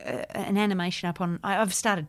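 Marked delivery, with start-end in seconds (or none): none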